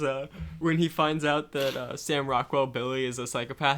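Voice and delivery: usual speaking voice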